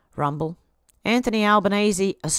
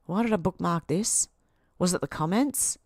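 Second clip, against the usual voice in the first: ordinary talk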